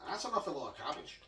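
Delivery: lightly voiced pal teasing about the cabbage; Charlie Boy's voice. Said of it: "that's Charlie Boy that speaks like that".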